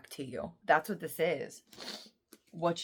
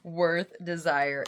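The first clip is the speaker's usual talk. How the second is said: ordinary talk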